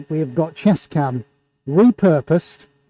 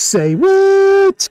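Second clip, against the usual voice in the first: high, loud "say what", last word stretched; playful shout; 6 dB louder than usual. Pretend. Greeting viewer Denise by name, playful shout. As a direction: whoop out the catchphrase.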